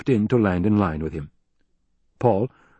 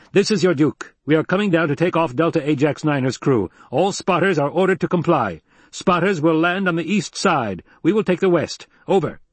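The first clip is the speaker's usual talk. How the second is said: higher-pitched radio orders, lightly acted; commanding Duke; 7 semitones higher than usual. Duke ordering all spotters to land, commanding radio voice. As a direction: issue orders as a leader in command.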